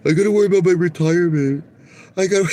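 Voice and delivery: mock his excuse in a whiny voice; hammed-up whining executive; mock whiny CEO. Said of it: "mocking CEO excuse".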